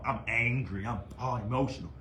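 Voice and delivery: a low, soft mock of a whiny critic. Mocking Brian's complaint to viewers, low and soft mimicry. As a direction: parody the critic's complaint, lightly. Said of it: "you say that, you know".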